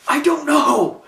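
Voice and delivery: high, loud laughing cry; Paul Heyman voice. Retold or imitated. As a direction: shout it high through a laugh. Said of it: "he's like this".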